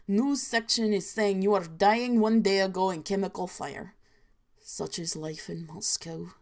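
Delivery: low, deadpan fake Russian accent; inaccurate Russian accent. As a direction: narrate the grim dream in a hammed-up Russian accent. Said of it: "inaccurate Russian accent".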